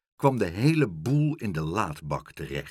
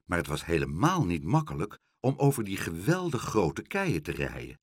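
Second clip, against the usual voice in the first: ordinary delivery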